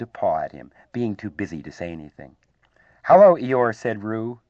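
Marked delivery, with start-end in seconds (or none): 3.0-3.7 loud, higher-pitched eager hello; excited greeting. A narrator's reading. Excited youngster greets the gloomy guest, excitedly. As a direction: call it out eagerly.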